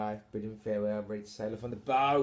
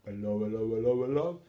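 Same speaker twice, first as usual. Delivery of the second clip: comic policeman. Acted. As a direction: mimic a stock British copper. Imitates arriving police officers.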